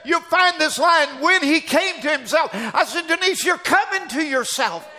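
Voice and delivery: fervent preaching. Retold or imitated. Preacher on the phone at night urging her home, fervent. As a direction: preach hope into her with fire.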